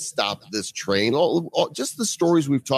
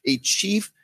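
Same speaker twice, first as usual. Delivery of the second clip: ordinary talk, nothing marked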